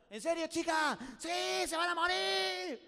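high, loud, drawn-out yell; shouted discouragement. Acted. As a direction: holler a warning down a well. Frogs above yell down. (gritos de advertencia alargados)

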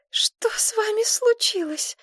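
high, slow, breathy whisper; breathless exclaim. A narrator's reading. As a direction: whisper it in horror, slowly. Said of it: "воскликнула Мария". (сдавленный испуганный шёпот)

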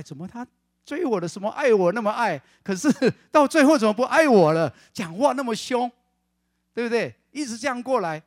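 high, loud complaining wife; aggrieved wife. Retold or imitated. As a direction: whine the grievance up high and loud. 妻子抱怨丈夫变了. (太太委屈地大声抱怨)

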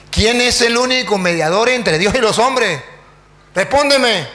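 loud, insistent questioning; demanding challenge. Acted. Priest presses the silent pastor before everyone, loudly demanding. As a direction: raise the voice and demand an answer. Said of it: "Yo no tuve miedo, yo respondí".

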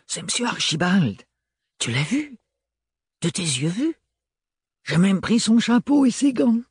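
high, excited chattering maids; gossiping maids. A narrator's reading. Gossiping servants voiced by narrator.

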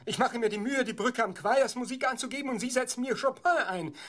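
the offended author who won't stand for it; indignant protest. Acted. Author protesting to the director, getting indignant.

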